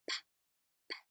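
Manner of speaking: crisp repeated p puffs; isolated p sound. Shown as a demonstration. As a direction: pop the lips on p. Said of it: "the letter that makes the p, p, p sound".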